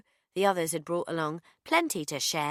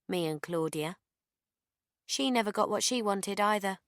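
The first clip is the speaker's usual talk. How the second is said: ordinary delivery, nothing marked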